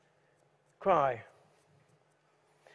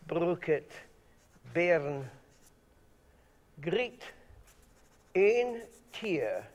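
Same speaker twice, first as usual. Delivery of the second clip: slow, rolling broad Scots recital; much slower than usual. Acted. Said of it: "is in broad Scots".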